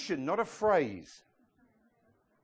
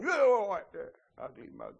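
gruff banter. Retold or imitated. Pastor jokes back to the Lowe's guys, gruff banter. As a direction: rib them like an old mate. Pastor's joking reply to staff.

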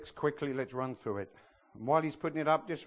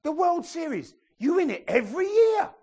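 loud, high-pitched exaggerated marvelling; mock amazement; 11 dB louder than usual. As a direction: gush at full volume, voice shooting up. British comedian mocking the World Series.

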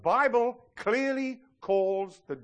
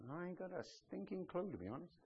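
much softer confiding admission; hushed aside; 17 dB quieter than usual. Real, not acted. Pastor admits to the church, hushed aside. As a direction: let them in on a secret.

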